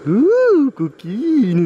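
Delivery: high, loud playful coo at the crayfish; teasing. Real, not acted. Taquine les écrevisses qui volent l'appât. (le pêcheur taquine les écrevisses, voix aiguë et joueuse)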